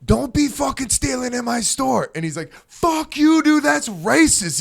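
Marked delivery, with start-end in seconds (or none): 0.0-2.1 imitating a shouting shop clerk, pitched much higher; mock clerk yell. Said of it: "starts screaming at him from behind the counter". 2.7-4.6 imitating an indignant yell, pitched much higher; mock angry yell. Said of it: "so he's screaming and yelling".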